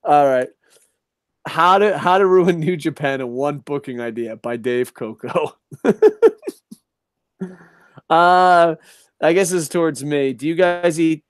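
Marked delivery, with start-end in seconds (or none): 5.7-6.5 sudden high squealing laugh. Real, not acted. Laugh at title.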